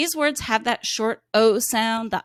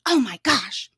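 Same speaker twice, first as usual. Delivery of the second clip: mock surprise. Shown as a demonstration. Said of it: "So that's like".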